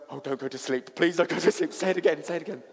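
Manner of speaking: high, loud, urgent begging; excited pleading. Retold or imitated. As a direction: beg the baby in sudden delight. Excited begging of baby after first word.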